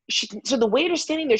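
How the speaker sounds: plain reading voice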